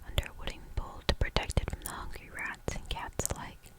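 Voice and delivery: plain reading voice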